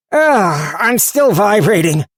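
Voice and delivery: high, loud, rattled little knife voice; whiny dizzy blade; talking knife. Acted. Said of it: "said the knife".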